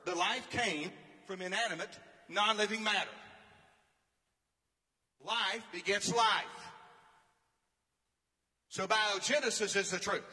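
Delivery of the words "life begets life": slow, weighty proclamation of each word; preached emphasis. Acted. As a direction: pound it out like a pulpit declaration. Preacher declaring truth.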